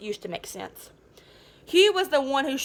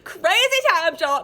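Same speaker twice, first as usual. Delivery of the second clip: excited; 6 semitones higher, 5 dB louder than usual. Real, not acted. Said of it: "repeated exclamation".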